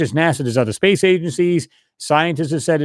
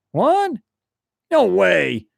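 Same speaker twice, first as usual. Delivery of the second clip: mock disbelief; 4 semitones higher, much slower than usual. Friends react to Mars photo.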